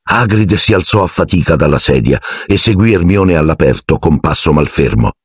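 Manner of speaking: ordinary delivery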